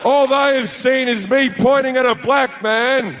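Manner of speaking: normal talking voice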